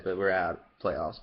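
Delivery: usual speaking voice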